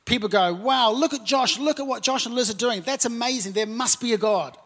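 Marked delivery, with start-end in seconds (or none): none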